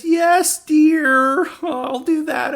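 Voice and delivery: high, meek mock husband giving in; meek reply. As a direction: chirp it like a puppy-like husband obeying. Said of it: "he just meekly says".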